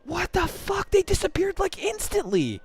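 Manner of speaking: very high outraged squeal; shocked disbelief. Real, not acted. Gamer seeing portals disappear instantly, high-pitched shock. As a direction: protest in high, incredulous alarm.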